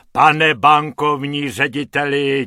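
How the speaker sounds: loud, merry thanks from the organ grinder; beaming, exuberant gratitude; jolly calling out. A narrator's reading. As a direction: call out, overjoyed. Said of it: "volá harmonikář ... nýbrž rozjařeně".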